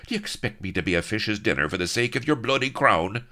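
fast, high-pitched exasperated protest. A narrator's reading. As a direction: build indignantly to the last words. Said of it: "slightly exasperated".

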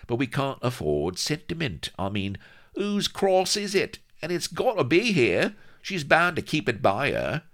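very high-pitched sidekick voice, swearing in exasperation; high, excitable crewman; agitated pirate sidekick. A narrator's reading. Voices Vladimir in story.

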